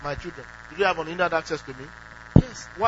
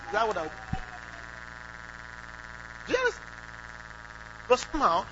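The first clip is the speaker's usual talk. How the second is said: ordinary delivery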